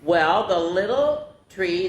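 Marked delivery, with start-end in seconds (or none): none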